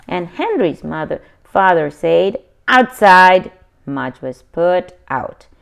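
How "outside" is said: much louder firm command; stern order. A narrator's reading. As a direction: send the dog out. Father orders dog out.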